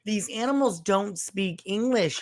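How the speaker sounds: hammed-up disbelief at the screen; exasperated mock rant. Viewer rants at the movie characters, mock exasperation.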